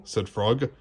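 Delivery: ordinary delivery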